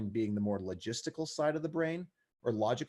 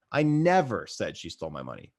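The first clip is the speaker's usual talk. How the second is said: big rise and fall on "never"; stress on never; 4 semitones lower, 4 dB louder than usual. Shown as a demonstration. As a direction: hit the word "never", let the rest drop. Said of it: "depending on where I put the emphasis".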